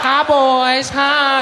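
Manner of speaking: high coy greeting; flirty beach woman.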